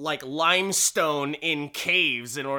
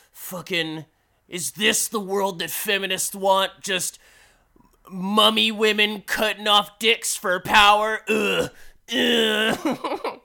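higher, louder sarcastic outrage; hammed-up disgusted rant with groans; mock outrage; 7 semitones higher, 5 dB louder than usual. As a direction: play a scandalised blowhard. Comic mock outrage at feminists.

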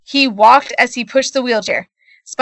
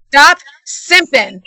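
slow, drawn-out bad country accent; 3 semitones higher, 3 dB louder, much slower than usual. A narrator's reading. The Rock as God to his disciple, bad country accent. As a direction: put on a bad country twang, slowly. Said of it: "in a very bad country accent".

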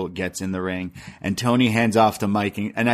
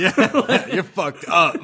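high, cracking up; amused, higher-pitched joke quote; laughing paraphrase; 9 semitones higher, slower than usual. Pretend. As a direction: giggle through the mock insult. Joking paraphrase while laughing.

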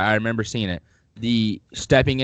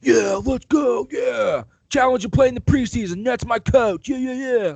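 high, fired-up mock shout of the quarterback; hammed-up hyped quarterback voice; Baker Mayfield hype; 13 semitones higher than usual. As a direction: play the pumped-up QB yelling about his coach. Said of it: "Baker Mayfield was like".

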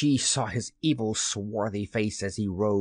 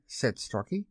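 plain reading voice

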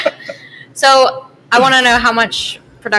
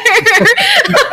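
high delighted laugh of pleasure; 14 semitones higher, 5 dB louder than usual. Real, not acted. Delighted laugh after tasting.

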